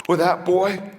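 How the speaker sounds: normal talking voice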